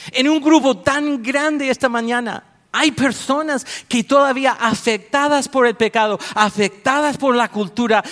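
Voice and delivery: fervent preaching. Acted. Preacher challenges the congregation. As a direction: press the congregation with rising fervor.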